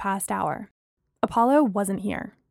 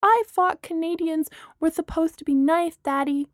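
put-on little girl; 7 semitones higher than usual. A narrator's reading. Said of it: "the little girl said to her father".

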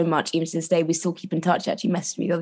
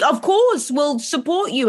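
higher, reassuring; supportive parents; 7 semitones higher than usual. Retold or imitated. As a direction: reassure kindly. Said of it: "They were like".

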